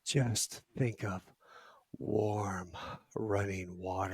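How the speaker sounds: slow, soft, soothing voice; calm him like a hypnotist, slowly; soothing hush. Retold or imitated. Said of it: "she said it just like that".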